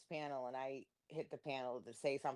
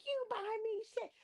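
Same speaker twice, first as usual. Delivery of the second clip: high whiny mock woman's voice; mocking her. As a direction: squeal her complaints up high. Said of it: "She's like".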